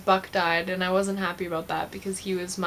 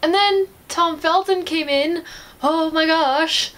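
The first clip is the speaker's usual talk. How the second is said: loud, high, drawn-out excitement; excited fangirl gushing; 9 semitones higher, 5 dB louder, slower than usual. Real, not acted. Vlogger reaching her favourite actor's entrance, excited fangirl gushing. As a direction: gush it slowly, pitched way up.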